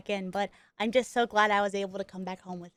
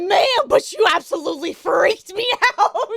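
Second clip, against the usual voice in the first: louder and higher, laughing through it, slow; laughing relief; 8 semitones higher, 8 dB louder than usual. Retold or imitated. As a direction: laugh out your relief at her. Relieved after prank reveal.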